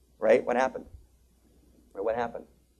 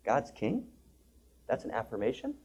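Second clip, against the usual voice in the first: skeptical question; 2 semitones lower, 11 dB quieter than usual. Retold or imitated. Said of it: "As the Kabbalists say".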